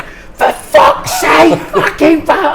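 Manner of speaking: mock-yell at the dog, half laughing; retold exasperated shout. Retold mate cursing dog.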